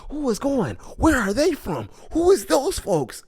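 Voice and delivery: high, wondering crowd voice; awed onlookers. Retold or imitated. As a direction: gawk at them in rising pitch. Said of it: "everyone's just like".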